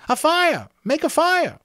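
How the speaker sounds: put-on the boys leap at the idea; the crowd echoing the fire plan, excited and loud; excited shout.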